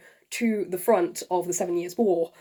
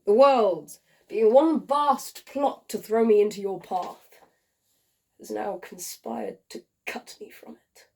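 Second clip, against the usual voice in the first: tragic love letter. Acted. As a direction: read it like a dying man's farewell. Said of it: "performed by yours truly".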